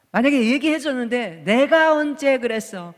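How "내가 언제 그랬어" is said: mock-angry retort, louder and higher; indignant denial. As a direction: act out a drawn-out, defensive protest. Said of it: "막 그러면서 화를 내면".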